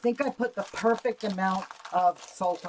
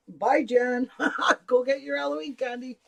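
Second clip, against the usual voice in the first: raised, higher laughing goodbye; laughing call; 7 semitones higher, 6 dB louder than usual. Real, not acted.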